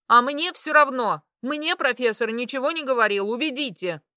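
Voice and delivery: high, loud indignant protest; angry outburst. A narrator's reading. Woman in charge overruling orderlies' objection, loud and angry.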